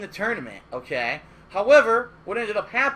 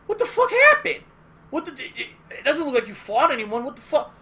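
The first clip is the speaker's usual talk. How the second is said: much higher, loud shocked outburst; 6 semitones higher, 5 dB louder than usual. Retold or imitated. Organizer seeing bloody knuckles, shocked disbelief. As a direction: blurt it out in disbelief.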